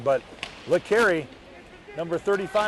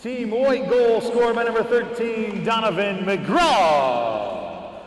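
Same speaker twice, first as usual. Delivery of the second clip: echoing stadium announcer; slower than usual. Acted. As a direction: stretch the name like a rink announcer. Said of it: "let's hear from the stadium announcer".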